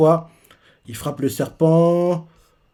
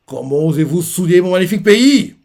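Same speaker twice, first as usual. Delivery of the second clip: mock outraged demand; 4 semitones higher, 5 dB louder than usual. Sun god outraged.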